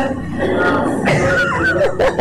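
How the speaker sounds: high, bursting laugh. Real, not acted. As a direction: let the laugh break out high. Laughing at husband's joke.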